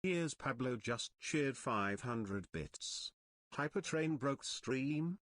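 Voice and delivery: robotic alert voice, low and slow; text to speech voice. Acted. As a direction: read like a donation alert bot. TTS alert voice reading donation.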